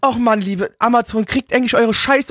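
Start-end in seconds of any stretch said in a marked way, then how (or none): none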